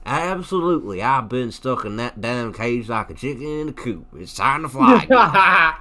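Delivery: hammed-up Southern American accent. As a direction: play it as a Southern belle itching to fly. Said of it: "A really good Southern accent for a British guy".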